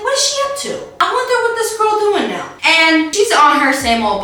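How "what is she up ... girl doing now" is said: high-pitched, curious self-quote; nosy wondering. Retold or imitated. Host recalling her suspicion about this girl, high nosy wondering. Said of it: "And I was like".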